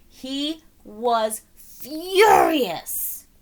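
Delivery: very slow, heavy furious; drawn-out fury. A narrator's reading. Narrator dwelling on McGreely's fury, very slow. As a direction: stretch furious out.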